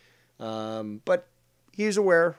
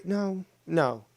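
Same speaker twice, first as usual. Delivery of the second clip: ordinary talk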